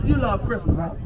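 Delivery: usual speaking voice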